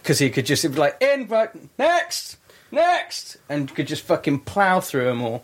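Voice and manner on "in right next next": high, drawn-out mock call of "next"; brisk hangman calling out. Imitating a hangman processing prisoners, high mock call. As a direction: call them through like a queue.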